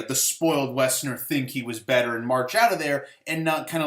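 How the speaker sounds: normal talking voice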